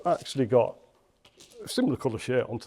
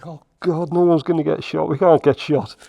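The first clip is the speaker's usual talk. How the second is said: louder, higher burst of alarm; retold panic; 4 semitones higher, 10 dB louder than usual. Recalling his thoughts at gunpoint, in retold panic. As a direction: blurt it out as a remembered fear. Retold fear of being shot.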